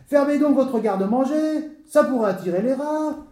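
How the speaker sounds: high-pitched angry insult; irate official's jeer; furious. Acted. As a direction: scold him rudely, voice pitched high. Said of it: "parut frapper de folie furieuse".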